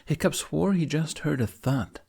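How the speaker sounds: ordinary delivery, nothing marked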